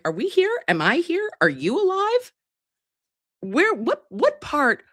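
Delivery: high bewildered rising voice; disbelieving squeak. Retold or imitated. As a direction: sound utterly baffled. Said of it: "I'm like".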